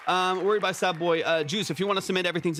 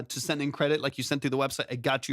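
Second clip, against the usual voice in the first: ordinary talk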